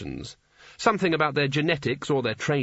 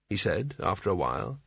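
plain reading voice

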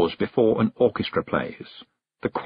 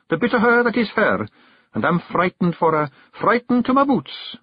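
put-on much higher boyish Scots voice, frightened; a lad confessing his fear for her; young Billy, earnest and scared; 9 semitones higher than usual. A narrator's reading. Billy tells Rob his fear for the hag, frightened high voice.

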